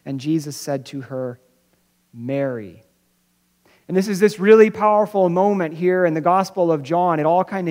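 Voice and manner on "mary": tender name. Retold or imitated. Jesus speaking her name tenderly.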